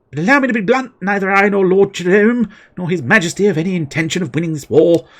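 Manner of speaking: high, grand villain's speech; mock villain. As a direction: read out like a scheming lord. Reading game character's lines aloud.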